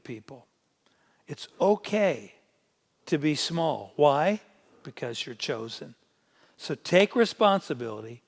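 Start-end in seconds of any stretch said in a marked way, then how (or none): none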